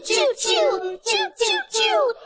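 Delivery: high sung choo choo; high train whistle choo. Pretend. Train noise imitation.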